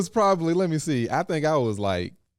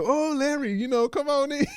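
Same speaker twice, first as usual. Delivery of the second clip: much higher, sugary welcome; sweet welcoming madam. Retold or imitated. Madam welcoming a regular customer, high and sugary. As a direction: coo the regular inside. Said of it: "she's calling them out by name".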